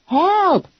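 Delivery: high, urgent yell; yell for help. Acted. Pinned face down in the mud, yelling for help. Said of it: "I yelled".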